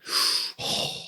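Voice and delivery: heavy mechanical mask breathing; slow, hollow in-and-out breaths; imitated Darth Vader breathing.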